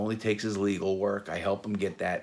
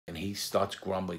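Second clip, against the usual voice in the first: ordinary delivery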